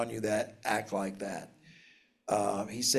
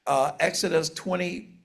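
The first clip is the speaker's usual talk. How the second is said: ordinary talk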